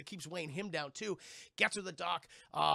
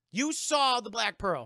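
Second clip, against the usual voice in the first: loud, slow incredulous question; a loud, drawn-out challenge; loud disbelief; 3 semitones higher, 8 dB louder, slower than usual. Acted. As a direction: demand it in disbelief. Guard challenging the other guard.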